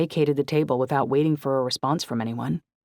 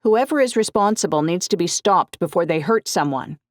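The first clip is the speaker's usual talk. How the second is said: higher, louder news-clip neighbor; community woman; 5 semitones higher, 5 dB louder than usual. Acted. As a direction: speak as a worried local on TV.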